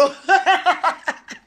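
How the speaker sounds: high, real burst of laughter. Real, not acted. Laughing at her own story.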